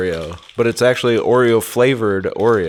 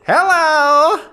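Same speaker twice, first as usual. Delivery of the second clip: high squeaky hello; falsetto hello; 3 dB louder than usual. Acted. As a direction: pitch the hello way up. Echoing greeting in falsetto.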